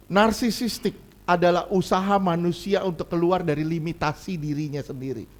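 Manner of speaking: ordinary talk, nothing marked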